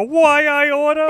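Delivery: high, drawn-out mock dumb blonde. Joking about the worst voice nobody wants to hear, high blonde imitation. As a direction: exaggerate the squeaky go-to blonde voice, slowly. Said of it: "we've all know that voice".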